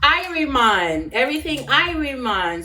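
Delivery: laid-back Jamaican greeting; Jamaican patois. Retold or imitated. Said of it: "they say".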